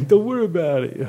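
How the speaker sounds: high, loud and laughing; mock-casual reassurance through laughter; laughing don't worry about it. Repeats quote laughing loudly.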